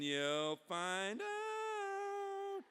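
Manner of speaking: creepy, very drawn-out warning rising high; Benjamin Rush. Acted. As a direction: stretch it out eerily, soaring in pitch. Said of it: "the creepy face of Benjamin Rush".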